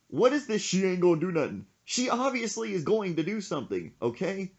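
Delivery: high-pitched incredulous scolding, acted out; retold exasperated protest. Retold exasperated argument with his friend.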